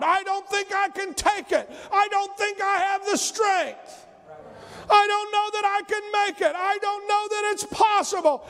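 high, pleading wail of self-pity; whiny despairing prayer. Retold or imitated. Praying believer complains to God in a high whine. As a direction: cry out to God like a sulking child. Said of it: "pray yourself into being sad and depressed".

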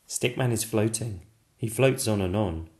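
usual speaking voice

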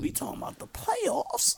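high, breathy mock disbelief; Allen Iverson impression. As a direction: playoffs asked in mock disbelief. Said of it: "Playoffs different".

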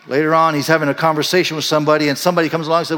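usual speaking voice

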